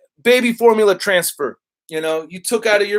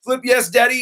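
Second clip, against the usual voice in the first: rattling off votes, slightly raised pitch; rapid-fire reading; 4 semitones higher than usual. Real, not acted. Reading chat's votes as fast as possible, rapid-fire. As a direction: race through the list without stopping. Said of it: "I'm trying to read it as fast as I can".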